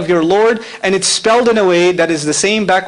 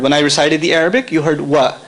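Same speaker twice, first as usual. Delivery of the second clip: usual speaking voice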